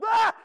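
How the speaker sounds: high, loud shriek of alarm; mock terrified scream at a rain cloud; panicked shriek. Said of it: "he's like".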